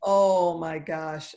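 ordinary talk, nothing marked

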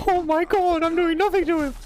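shrieking at the screen, helpless; frantic high yell. Real, not acted. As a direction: yell it as your punches do nothing. Losing a fistfight in game.

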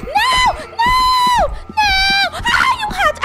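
high panicked cries; startled, high and breathless; frightened screaming. Real, not acted. Clown comes after her.

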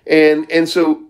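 ordinary talk, nothing marked

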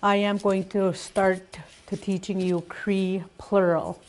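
ordinary talk, nothing marked

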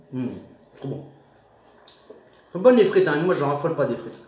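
chewing while talking; muffled by food; mouth full. Real, not acted. Seen 1: Fry eaten at -2; lips closed, jaw working while speaking.

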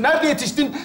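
ordinary talk

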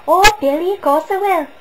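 high, loud, gushing Welsh lilt; mock Welsh accent. Mocking her answer to Billy, gushing Welsh accent. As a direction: overact it in a hammed-up Welsh accent. Mimics actress's keen reply to Billy.

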